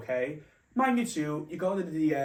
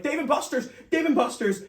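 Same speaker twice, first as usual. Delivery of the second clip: flustered correction; 11 semitones higher, 6 dB louder than usual. Retold or imitated. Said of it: "I'm like".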